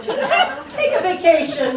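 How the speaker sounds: a joking quote broken by laughs; laughing. Retold or imitated. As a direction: toss it off through laughter. Mock letter told to sharks.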